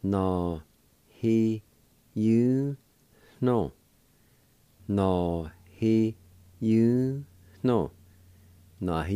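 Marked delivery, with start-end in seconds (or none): 0.0-3.7 syllable-by-syllable demo. Shown as a demonstration. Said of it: "It's pronounced like this". 4.9-7.9 slow, emphatic syllables with gaps between; syllable-by-syllable demo. Shown as a demonstration. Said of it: "It's pronounced like this".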